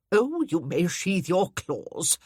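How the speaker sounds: put-on slow, dry grand old lady's rebuke; haughty dowager. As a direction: lean on it slowly, amused and superior. Narrator voices the Dowager.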